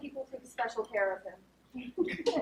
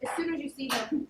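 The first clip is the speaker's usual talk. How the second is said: ordinary talk, nothing marked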